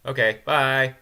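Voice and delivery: high, loud, cheery sign-off; breezy goodbye. Retold or imitated. The girl leaving abruptly chirps a breezy bye. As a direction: toss it off and leave. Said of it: "And then she's like".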